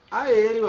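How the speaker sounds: Aéreo punched high and loud; teacher stressing Aéreo; emphatic demo. Shown as a demonstration. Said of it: "Olha aqui uma palavra".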